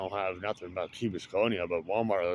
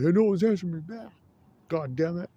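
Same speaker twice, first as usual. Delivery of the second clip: high, louder squawky mock-angry bird voice; voicing an angry bird; 10 semitones higher, 6 dB louder than usual. Voicing a pissed-off bird that nobody answers, high and mock-angry. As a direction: pretend bird cursing, pitched way up. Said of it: "he sounds like he's really pissed".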